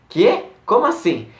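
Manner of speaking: high-pitched, taken-aback questioning; incredulous reply. Retold or imitated. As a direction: act out someone caught off guard by news. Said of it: "meio incrédula".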